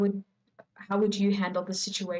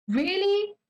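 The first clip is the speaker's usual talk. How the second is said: much higher, sharp indignant question; indignant really; 10 semitones higher than usual. Real, not acted. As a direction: throw it back in disbelief. Indignant reaction to the quoted words.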